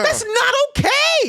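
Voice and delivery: slow, very high-pitched protest; hammed-up shock, stretched out high; scandalized. Acts own shocked objection.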